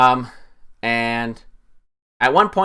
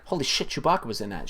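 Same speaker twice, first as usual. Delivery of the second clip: stunned aside; 9 dB quieter, faster than usual. Retold or imitated. Acts out stunned viewer reaction.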